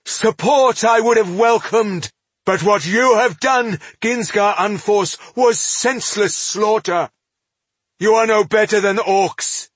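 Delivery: very high, loud outrage; furious denunciation. A narrator's reading. Elf leaps up denouncing dwarf's slaughter, furious. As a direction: denounce him at full heat.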